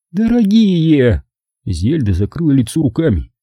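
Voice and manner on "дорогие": high, drawn-out exclamation; dismayed hostess. A narrator's reading. Zelda appeals to the men, high drawn-out voice. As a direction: stretch the word out, pitched high.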